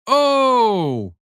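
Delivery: high, loud hammed-up "oh!"; mock surprise. A narrator's reading. Father teasing his friend, hammed-up loud surprised exclamation. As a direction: fake being shocked, big and high. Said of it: "Jonas exclaimed as if surprised".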